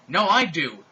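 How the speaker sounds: loud insistent shout. A narrator's reading. A student shouting back, loud. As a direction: belt out the refusal.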